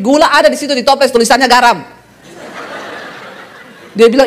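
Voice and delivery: normal talking voice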